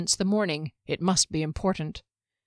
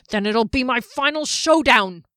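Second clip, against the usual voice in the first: higher, louder gruff roar; defiant shout; 4 semitones higher, 6 dB louder than usual. Acted. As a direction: bellow it in defiance.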